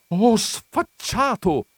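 high, loud, drawn-out insult; outraged cry. A narrator's reading. Countess losing her temper at the visitor, outraged cry. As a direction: cry out, losing all patience. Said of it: "gridò la contessa".

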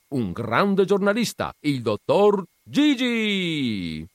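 loud, high applause call; announcer call. A narrator's reading. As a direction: whip up the crowd's applause. Clown calls for applause.

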